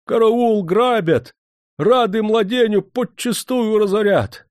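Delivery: high, loud cry for help, drawn out at the end; alarmed shouting. A narrator's reading. Steward yelling in the office that they are being robbed, alarmed shouting. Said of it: "кричал".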